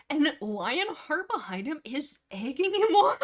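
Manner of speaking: loud, high, slow, breaking into a laugh; cracking up at the absurdity; laughing disbelief. Real, not acted. Retelling a book scene to viewers, laughing disbelief.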